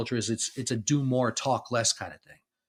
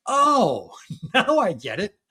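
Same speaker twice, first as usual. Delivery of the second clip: high, loud dawning "oh"; sudden realization; 9 semitones higher, 7 dB louder, slower than usual. Retold or imitated. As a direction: act out the moment it finally clicks. Acts out his sudden realization.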